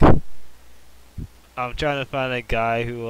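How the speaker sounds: drawn-out loud call, slowed way down; slow, loud retelling of a girl asking around; mock loud plea. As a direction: stretch it out loud like a searching schoolgirl. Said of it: "and she's like".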